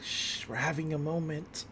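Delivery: mock hushing. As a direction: hush them as if guarding a private moment. Hushing someone during a tender moment.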